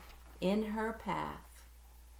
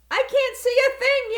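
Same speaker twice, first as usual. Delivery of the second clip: high, loud panicked yell; 12 dB louder than usual. Acted. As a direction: yell it blind and scared. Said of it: "yelled Pip".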